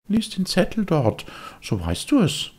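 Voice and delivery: put-on high, know-it-all kid; a cocky boy brushing him off; smug boyish voice; the boy's voice. The boy answers.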